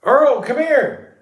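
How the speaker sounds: high, loud, drawn-out call. Real, not acted. Coaxing his pet out of the dark, loud high calling. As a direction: call him over across the dark room.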